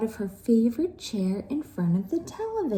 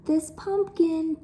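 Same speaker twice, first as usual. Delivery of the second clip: higher voice calling for help; 8 semitones higher than usual. Acted. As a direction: call to the sisters, asking. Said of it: "Tilda called to the others".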